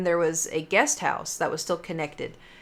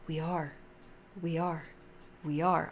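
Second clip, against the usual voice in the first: much softer, looping eerie we are; quiet ghostly repeat; 3 semitones lower, 11 dB quieter than usual. Retold or imitated. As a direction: replay the faint voice over and over. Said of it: "it was".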